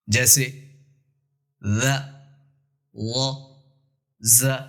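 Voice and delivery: ذا said thin, وا said full and heavy, زا said with a hiss; thin, heavy and whistled z. Shown as a demonstration. Teacher demonstrating three z letters to students. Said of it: "ذال باریک پڑھی جاتی ہے".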